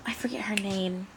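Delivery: whispered aside to viewers. Real, not acted. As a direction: breathe it out as a hushed aside.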